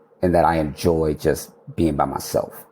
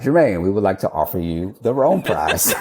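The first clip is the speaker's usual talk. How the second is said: quoting the caller through a laugh; can't keep the laugh out of it; 4 semitones higher than usual. Retold or imitated. Retelling the prize call, laughing.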